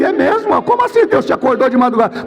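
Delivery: very high, incredulous questions; acted high-pitched astonishment; startled disbelief. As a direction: question the news in alarm. Retold startled reaction on phone.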